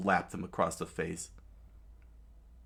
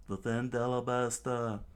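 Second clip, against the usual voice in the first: mocking echo; 4 semitones higher than usual. Crocodile mocking the guards' battle cry. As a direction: Defend Alabasta said lightly, sneering.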